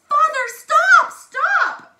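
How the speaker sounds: put-on very high, loud child's shout. A narrator's reading. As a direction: stop him in panic. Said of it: "shouted Walter Junior".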